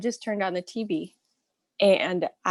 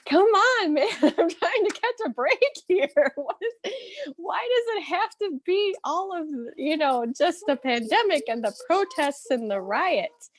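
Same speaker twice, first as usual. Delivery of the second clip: frustrated outburst; 10 semitones higher than usual. Retold or imitated. Fed up with pandemic news on every show, exasperated. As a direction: throw up your hands at the TV, pitched up high. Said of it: "I was just so frustrated".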